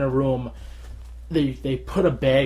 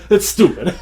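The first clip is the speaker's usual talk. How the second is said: laughing through a sheepish agreement, higher and louder; 5 semitones higher, 8 dB louder than usual. Retold or imitated. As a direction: giggle it out, embarrassed. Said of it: "I'm like".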